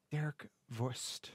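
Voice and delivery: slow, soft, goofy German name Derek Wurst; lightly hammed German accent, quiet and slow. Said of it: "I feel the need to make that so German".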